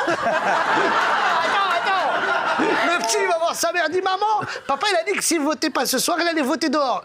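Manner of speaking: high, breaking with laughter; fighting off giggles as he goes on; laughing. Real, not acted. Telling the punchline setup to the crowd, giggling. Teller cracks up mid-joke.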